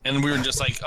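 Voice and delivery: ordinary talk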